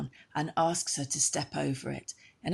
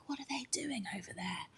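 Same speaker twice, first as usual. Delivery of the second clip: whispered wondering; 8 semitones higher, 10 dB quieter, faster than usual. Acted. Arianrhod peers from window.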